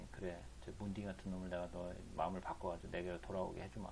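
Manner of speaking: soft, low voice of assent; imitated God's answer. Preacher acting God answering a prayer, quiet and assenting. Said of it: "하나님이".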